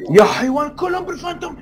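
excited shout. Real, not acted. All the enemies have Phantoms.

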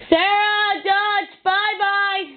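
high, slow sing-song goodbye; sing-song to dogs. Real, not acted.

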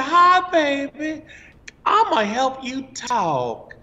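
high, slow, drawn-out Mississippi drawl; Missus Spears. Retold or imitated. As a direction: imitate a Southern teacher greeting a child, high and slow. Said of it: "she talked like this".

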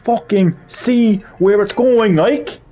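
real frustration boiling over; exasperated rant. Real, not acted. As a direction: vent at the game, stretching the words. Ranting at awkward controls.

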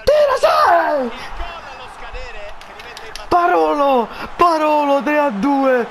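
ecstatic goal call. Real, not acted. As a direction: scream the winning goal. Gol vittoria urlato. (urlo estatico da telecronista)

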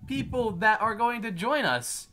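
high, loud proclamation from the stand; big high-pitched welcome speech, punched at the end; Ron addressing newcomers. Acted. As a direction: announce it grandly to a crowd of recruits. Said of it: "got up on the stand and was like".

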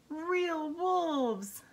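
high, drawn-out dread; alarmed cry. A narrator's reading. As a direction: stretch it out in storybook alarm. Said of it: "he cried".

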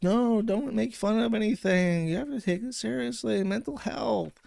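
high, slow, preachy mock voice; mocking moderator. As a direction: lay on a high sanctimonious whine, slowly.

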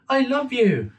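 put-on high, sweet little boy. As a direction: say it like a small child, high up. Child Dimitri voiced in story.

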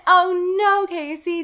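high, loud melodramatic lament; hammed-up cliffhanger reaction; mock horror. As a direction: wail it in pretend dismay.

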